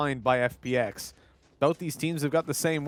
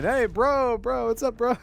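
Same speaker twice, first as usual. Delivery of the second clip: higher, imitating a player; mock bro greeting; 7 semitones higher, slower than usual. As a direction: greet everyone like a bro, for a joke. Acts out Mixwell saying bro.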